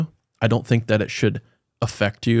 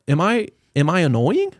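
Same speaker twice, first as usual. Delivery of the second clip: self-doubting question; 7 semitones higher, 3 dB louder than usual. Retold or imitated. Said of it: "ask yourself".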